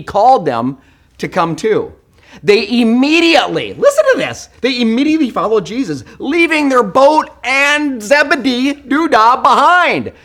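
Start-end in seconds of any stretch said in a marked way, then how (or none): 2.4-10.1 amazed preaching. Real, not acted. Preacher amazed the brothers left their father behind. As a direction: marvel at how fast they left.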